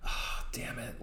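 whispered "damn it"; quiet groan. Retold or imitated. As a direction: breathe it out in defeat. Fan reaction to the anti-war message.

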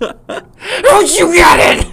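very high, loud, slow wail; mock anguished scream. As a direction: howl it, half crying. Dad yelling at baby.

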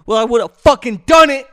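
angry yelling at critics; real yell. Real, not acted. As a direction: scream it out in frustration. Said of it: "I'm just yelling".

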